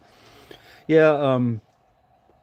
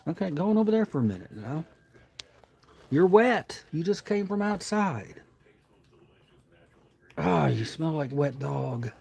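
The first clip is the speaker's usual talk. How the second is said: high, fond pet voice; talking to a dog; 5 semitones higher than usual. Real, not acted. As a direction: coo at the wet dog. Baby talk to the wet dog.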